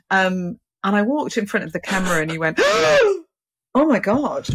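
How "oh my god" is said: sharp high gasp then awed "Oh my God"; be stunned by what you see; high gasping astonishment; gasp of amazement. Retold or imitated. Photographer retold amazed.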